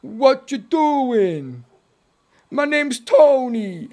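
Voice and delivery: loud, slow hammed-up New York voice; New York accent. Streamer trying a New York accent for chat, loud and slow. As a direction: play a big brash New Yorker. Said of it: "then you think of New York".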